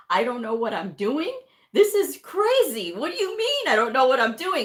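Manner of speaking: rising to an outraged high yell; indignant outburst. Retold or imitated. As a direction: sputter it like an insulted doctor. Indignant provider quoted.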